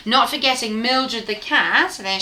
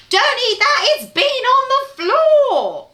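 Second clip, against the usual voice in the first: high, loud alarmed cry; cried out; 5 dB louder, slower than usual. Acted. Mum rushing in to stop her son eating the sausage, a high loud cry. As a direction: stop him before he eats it. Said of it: "she cried".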